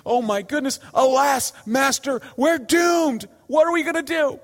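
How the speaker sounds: high, panicky cry of doom, rising to a shout; freaking out. Retold or imitated. Servant panicking at the army, crying out to Elisha in high alarm. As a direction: play the terrified servant wailing to his master. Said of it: "the servant starts freaking out".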